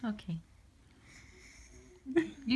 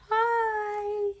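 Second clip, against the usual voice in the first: put-on high singsong hi for the baby; high baby-talk hi; 8 semitones higher than usual. Dad greeting baby Jackson, high coo.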